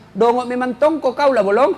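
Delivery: a hint of a harsh wife hurling insults; rude insults. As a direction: berate the husband like a shrewish wife. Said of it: "Kalau kita bicara kasar terus".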